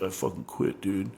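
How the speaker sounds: a soft, voiceless mutter of I quit. Retold or imitated. Quitting drummer Tony mutters to his bandmate, soft and voiceless. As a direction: breathe it out, fed up and barely voiced.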